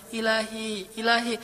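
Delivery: soft pleading call; softly acted prayer; tender supplication. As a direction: call on God gently, hushed. Models a tender plea to God.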